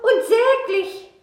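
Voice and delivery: high, loud, slow pitiful wail; piteous high-pitched animal voice, drawn out; wailing hare. A narrator's reading. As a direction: whine miserably as a freezing little hare. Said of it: "Der schreit und jammert kläglich".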